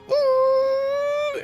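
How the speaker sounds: high, loud sustained "ooh"; wailing imitation of a blaring sound; mock scream. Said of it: "it's, like, screaming".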